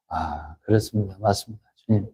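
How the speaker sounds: low, hushed acted prayer; softly acted submission; humble assent. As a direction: drop low and soft, conceding to God.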